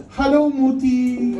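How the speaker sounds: high, drawn-out singsong greeting; daughter's greeting. Retold or imitated. Daughter greeting her mother at home, sweet singsong. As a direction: imitate a sweet little daughter singing hello. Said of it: "she comes home and she goes".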